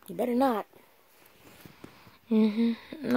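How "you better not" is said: mom voice. Pretend. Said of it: "put my mom voice on".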